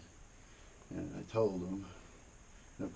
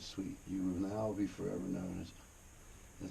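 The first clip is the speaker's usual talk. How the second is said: ordinary talk, nothing marked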